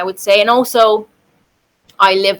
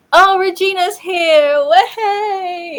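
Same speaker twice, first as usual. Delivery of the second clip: excited greeting; 9 semitones higher, 5 dB louder, slower than usual. Real, not acted. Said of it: "greets friend arriving".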